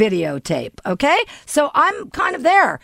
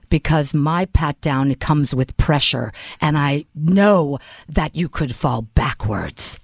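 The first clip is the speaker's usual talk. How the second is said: very low monotone officer voice; imitating a stern agent, low and monotone; deadpan agent; 11 semitones lower, slower than usual. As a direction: drop deep and deliver it level and deliberate. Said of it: "She goes".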